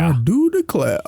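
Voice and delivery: put-on Southern accent, lightly done; mock Southern drawl. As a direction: join in with a cartoon Southern twang. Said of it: "that became Foghorn Leghorn".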